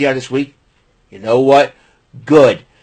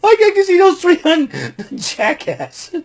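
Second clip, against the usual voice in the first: laughing mock voice.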